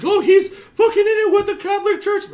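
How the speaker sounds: high, loud, laughing mock outrage; mocking the crowd. To friends, mocking how everyone reacted to JFK: high, loud parody. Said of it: "Everyone's like".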